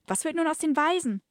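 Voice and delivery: high, put-on girlish question; damsel voice. Rescued woman asking about the orphans, high damsel voice. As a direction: ask in a hammed-up high damsel voice. Acted damsel asks her hero.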